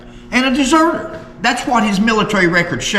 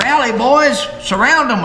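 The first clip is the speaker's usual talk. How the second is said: mild imitated holler; 6 semitones higher than usual. Host retells Jim Vance's bluff to viewers, mild mock holler. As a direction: imitate a holler without full volume. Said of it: "Jim Vance hollered".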